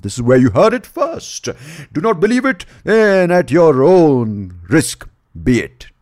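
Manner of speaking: loud, high mock proclamation; over-the-top show-host warning; hammed-up announcer. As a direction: proclaim it grandly, stretching the last words.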